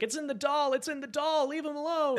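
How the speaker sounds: put-on high, pleading little girl voice near tears; little girl begging him to stop, voice pitched high; crying child. Sister begging the preacher to spare her brother, high tearful plea. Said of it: "Pearl starts to cry".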